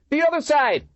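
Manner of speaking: loud, high warning shout; alarmed cry. A narrator's reading. Cop crying out a warning of flanking attack. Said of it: "a cop cry out".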